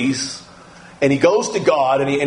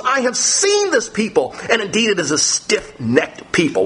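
high, intense preaching read of God's words; fiery sermon reading of scripture; impassioned scripture reading; 9 semitones higher than usual. Acted. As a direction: read it as God's outrage, full force. Preacher reads God's angry words.

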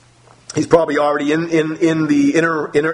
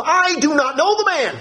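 high, insistent denial; Peter denying; 14 semitones higher than usual. Acted. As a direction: deny it with an oath, voice up high. Peter denies with oath.

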